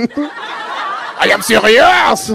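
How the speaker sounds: high, stretched-out comic French outrage; angry French accent. Retold or imitated. French barista insisting to mocking customer, comically angry French accent. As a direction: drag it out in mock French fury. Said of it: "Then he got really, really angry".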